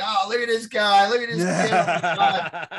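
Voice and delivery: high, laughing mock praise; mentor teasing. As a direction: ham up the old mentor ribbing the kid. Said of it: "he's like".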